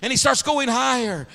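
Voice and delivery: high, loud, rising revival cry; full-force preaching. Real, not acted. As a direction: build to a shouted sermon climax.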